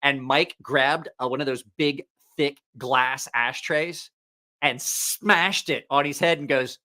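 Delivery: normal talking voice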